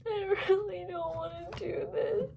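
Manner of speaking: tearful, barely getting the words out; real crying over a thesis she dreads. Real, not acted. Breaking down over thesis stress.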